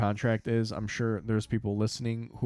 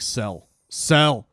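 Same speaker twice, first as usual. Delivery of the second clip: building from firm to a high shout; escalating mock-panicked yell; retold frantic selling; 4 semitones higher, 9 dB louder, much slower than usual. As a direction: repeat it louder and higher each time. Retelling frantic attempts to sell.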